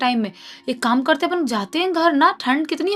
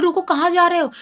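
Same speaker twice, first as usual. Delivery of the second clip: calling out; 6 semitones higher, 4 dB louder than usual. Acted. Wife calling after running husband.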